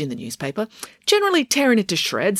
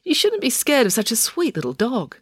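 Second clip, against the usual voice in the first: chiding; 8 semitones higher than usual. A narrator's reading. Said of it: "chided Loretta".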